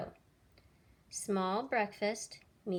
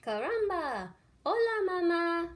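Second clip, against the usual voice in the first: much higher, louder tiny chick voice; baby chick; 9 semitones higher, 6 dB louder than usual. Acted. Newborn chick greets Jack as mama in tiny voice. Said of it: "looked up at Jack and said".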